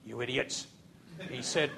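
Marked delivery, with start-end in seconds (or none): 0.0-0.7 lower, softer contempt; low scornful mutter. Retold or imitated. Sergeant scorns the recruits, low and quiet.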